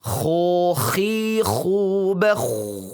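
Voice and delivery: "Kho, khi, khu" with rounded lips and a throaty rasp; rounded, snoring kho. Shown as a demonstration. Teacher shows kho with rounded lips and a throaty rasp. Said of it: "sedikit mencucu dan ngorok".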